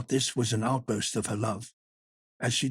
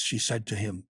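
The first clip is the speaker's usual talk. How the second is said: usual speaking voice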